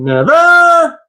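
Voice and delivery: sudden high, loud NEVER with a fall; a startled cry of Never!; shouted never. Shown as a demonstration. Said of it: "slow and then end strong?".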